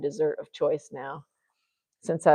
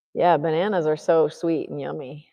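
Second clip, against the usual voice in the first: ordinary talk, nothing marked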